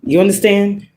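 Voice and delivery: usual speaking voice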